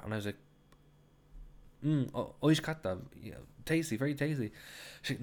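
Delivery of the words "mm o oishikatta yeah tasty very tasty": higher, more emphatic praise; trying harder. Retold or imitated. Guest told to say it more, effortfully emphatic. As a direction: push the compliment, trying to sound sincere. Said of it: "you have to say it more".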